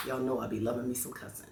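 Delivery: normal talking voice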